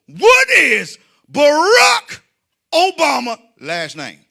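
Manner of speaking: high, loud shout with stretched words; bellowed quiz question. Retold or imitated. Comic quizzing a man for money, bellowed. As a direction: belt out the question, drawing out each word.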